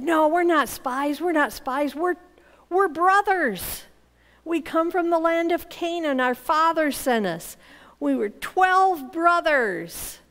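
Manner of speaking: acted protest of the wrongly accused; pleading denial. Brothers protest the spy accusation.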